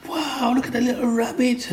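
gasp, then very high-pitched wonder; squeaky mock-child amazement; amazed kid. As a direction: imitate an awestruck child, voice way up high. Acts out amazed schoolchild seeing carving.

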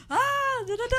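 high, sing-song imitation of a suddenly friendly driver; mock cheerful switch. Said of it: "He switched up".

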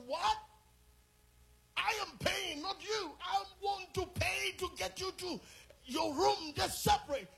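exasperated dad raising his voice. Retold or imitated. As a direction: push back hard at the son. Father confronting son over roommates.

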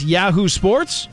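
ordinary delivery

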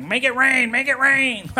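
very high-pitched mock yelling; high, sustained chant-like cry. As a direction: imitate a yelling dancer in a high voice. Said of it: "she started yelling at me".

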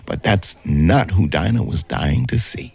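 ordinary delivery, nothing marked

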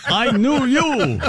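slow, high, mock Transylvanian drawl with a laugh; vampire accent. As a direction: ham up a campy vampire accent. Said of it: "She does it with a vampire accent".

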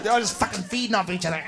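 ordinary delivery

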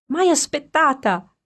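high, slow and bright; girl greeting her waiting friend, playful and high; cheerful exclamation. A narrator's reading. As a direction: tease him with a laughing voice. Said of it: "esclamò Alfonsina con gli occhi che ridevano".

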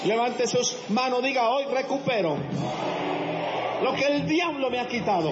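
proclamación muy fuerte y aguda; predicación fervorosa. Acted. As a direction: arengar a la congregación a gritos. Predica a pleno pulmón. (predicación a todo pulmón)

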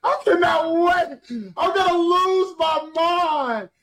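high, slow, drawn-out squeal; ecstatic anticipation. Real, not acted. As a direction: stretch every word in high-pitched excitement. Fan anticipating the concert.

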